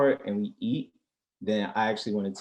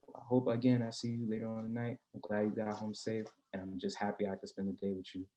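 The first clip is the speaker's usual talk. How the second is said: much softer, tender; soft sweet talk; 9 dB quieter than usual. Retold or imitated. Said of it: "you gotta be like".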